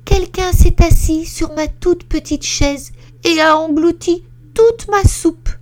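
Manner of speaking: very high, loud little bear squeak; baby bear wailing in a squeaky high voice; tiny voice. Acted. Little bear finds his soup eaten, squeaking high and loud. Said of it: "dit d'une toute petite voix".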